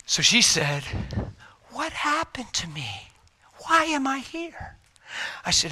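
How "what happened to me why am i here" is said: high, slow, airy questioning; imitating a frail, bewildered wife; weak trach voice. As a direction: ask it in a small, strained high voice. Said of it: "had a whisper 'cause of the trach".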